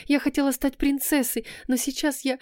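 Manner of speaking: put-on girl confesses to her beloved through tears; tearful. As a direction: let the words dissolve into crying. Camila sobs confession.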